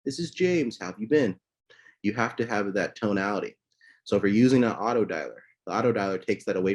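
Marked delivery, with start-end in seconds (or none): 0.1-1.4 friendly pickup. Shown as a demonstration. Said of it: "enough for him to say".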